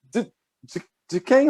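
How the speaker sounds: mock stammer rising very high; flustered mother, stuttering up into a high-pitched question; gaguejando. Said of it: "se gaguejando toda". (imitando a mãe gaguejando, bem agudo)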